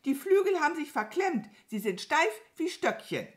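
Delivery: high, loud, hurried wail; frantic high-pitched whining. A narrator's reading. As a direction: complain in a bird's panic. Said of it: "jammerte sie".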